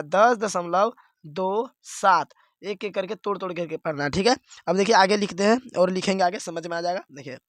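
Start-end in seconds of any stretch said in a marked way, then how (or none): 0.0-2.3 slow, say दो then सात as separate digits; slow correct reading, each digit after दशमलव on its own. Shown as a demonstration. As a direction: split the decimal digits apart, slowly. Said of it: "इसको ऐसे पढ़ना है".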